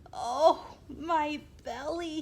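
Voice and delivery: loud pained wail, slow on belly. A narrator's reading. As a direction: groan it out like a sick knight. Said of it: "he wailed".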